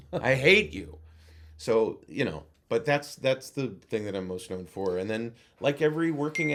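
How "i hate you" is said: escalated, louder I hate you; louder mock anger. Woman in a restaurant yelling at the AOL voice, mock anger. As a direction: punch hate harder the second time. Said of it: "she goes".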